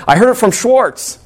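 high, put-on little boy voice; kid voice. Ralphie blaming his friend, in a high kid voice. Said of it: "so he said".

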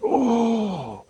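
slow, drawn-out mock reverence; hammed-up awe, starting high; imitated awe. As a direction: stretch each word as an awestruck host. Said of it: "His entire countenance changed".

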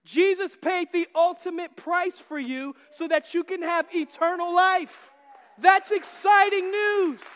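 rising to a loud, slow shout of good news; thrilled preacher, loud and slow at the end; excited. Shown as a demonstration. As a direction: proclaim it like the best news ever. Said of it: "That's how we should feel".

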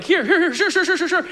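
hammed-up frantic victim with a laugh; panicked victim. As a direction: babble it out like a terrified man. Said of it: "he'd be like".

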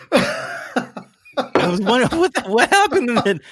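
talking through laughter, high-pitched; laughing. Real, not acted. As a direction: laugh while you speak. Laughing after a joke.

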